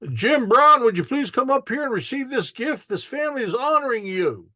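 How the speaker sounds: high, loud announcer call over the crowd; powwow announcer. Retold or imitated. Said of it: "you have that announcer announcing".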